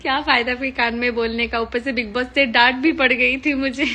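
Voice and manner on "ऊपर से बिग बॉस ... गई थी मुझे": words broken up by real laughter; laughing through it. Real, not acted. Telling fans Bigg Boss scolded her, laughing through it. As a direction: laugh while owning up to the scolding.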